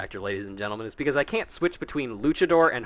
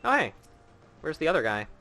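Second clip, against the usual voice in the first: usual speaking voice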